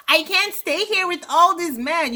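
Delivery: high-pitched mock protest; a little loud. Vlogger imitating Robin's complaint about the men, a little loud. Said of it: "Robin gets a little loud".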